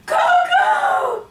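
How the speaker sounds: very high, drawn-out call. Retold or imitated. Owner calling her dog from behind, high shout. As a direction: cry the name out as if calling a lost dog.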